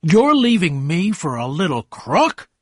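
loud, high incredulous question; narrated angry outburst; shouted. A narrator's reading. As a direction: raise the voice in outrage. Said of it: "Tom shouted".

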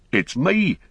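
shouting. A narrator's reading. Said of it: "shouted Sam".

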